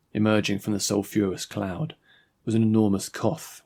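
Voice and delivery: usual speaking voice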